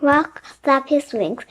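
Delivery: ordinary delivery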